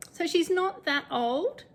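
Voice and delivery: ordinary talk, nothing marked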